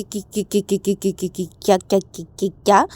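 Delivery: can't get past the word; stuck stutter. Acted. (अटकती हकलाहट)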